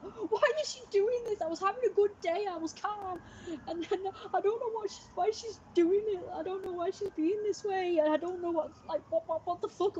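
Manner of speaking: high, frantic re-enacted rant; upset and flustered. Re-enacting their bewilderment at the other person's behaviour, upset, flustered and high-pitched. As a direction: act out a flustered meltdown, pitch way up and tumbling over the words. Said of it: "I was literally get, getting really upset and flustered".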